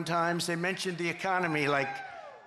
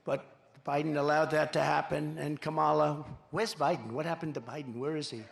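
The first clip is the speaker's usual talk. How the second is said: ordinary talk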